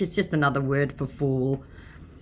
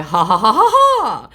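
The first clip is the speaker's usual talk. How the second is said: hammed-up slow cackle, higher; mock scammer laugh; 6 semitones higher, 7 dB louder than usual. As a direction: laugh like a gloating troll. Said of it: "he again added a".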